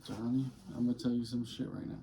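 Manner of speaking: ordinary delivery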